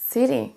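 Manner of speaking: "city" punched on its first syllable, falling after; stress on first syllable. Shown as a demonstration. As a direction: model "city" with the stress up front, then let it drop. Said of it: "On accentue sur la première syllabe".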